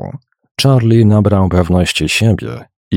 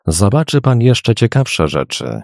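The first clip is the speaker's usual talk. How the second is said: plain reading voice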